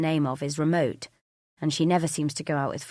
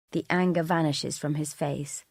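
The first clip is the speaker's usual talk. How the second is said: ordinary delivery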